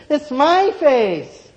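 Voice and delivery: put-on high, slow, loud girl voice; girl insisting. A narrator's reading. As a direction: draw out each word, high and insistent. Girl insists on her face.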